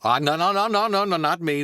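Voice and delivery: loud, high, flustered Goldblum Doc; panicked correction. Acted. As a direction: Doc telling Marty to film the car, loud. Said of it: "Doc Brown ... Jeff Goldblum".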